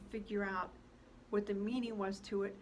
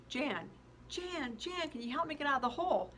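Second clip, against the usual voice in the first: calling out; 6 semitones higher than usual. Acted. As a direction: shout up to Jan, pleading. Calling up to her friend.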